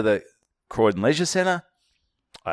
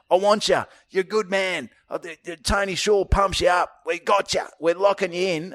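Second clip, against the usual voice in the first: gushing promoter; 7 semitones higher than usual. Retold or imitated. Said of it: "He goes".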